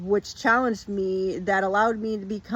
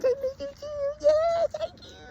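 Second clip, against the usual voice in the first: squeaky praising a dog. Real, not acted. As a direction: coo at the puppy in a tiny high voice. Cooing to her dog.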